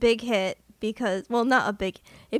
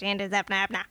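rattled off at top speed; fast garbled title; 3 semitones lower, faster than usual. Shown as a demonstration. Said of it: "I just like saying it as fast as I can".

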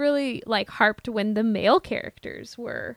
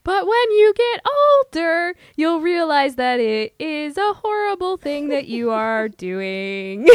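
louder, higher motherly lecture; lecturing mom; 4 semitones higher, 4 dB louder than usual. Pretend. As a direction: scold like a know-it-all mom.

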